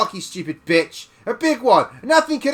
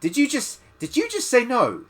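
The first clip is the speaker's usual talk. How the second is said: high, disbelieving question; mock disbelief at her refusal; bully outraged she said no; incredulous; 10 semitones higher than usual.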